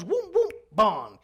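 loud, slow "boom, bong" noises; high, punched sound effect; imitated booming. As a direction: voice the thuds of words bouncing off. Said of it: "all of a sudden it goes".

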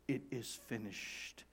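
low, slow, very soft; hushed finality. Acted. Jesus' words of accomplishment, hushed and final. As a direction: let each word land slowly and quietly. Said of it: "Words of accomplishment".

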